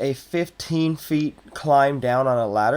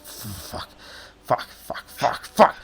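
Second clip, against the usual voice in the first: whispered curses building up; muttered cursing; 2 semitones lower, slower than usual. Acted. Goblin barkeep cursing to himself when pressed by the adventurer.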